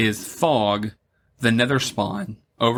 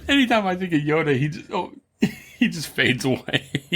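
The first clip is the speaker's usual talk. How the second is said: laughing while talking; 5 semitones higher than usual. Real, not acted. Laughing about Yoda fading.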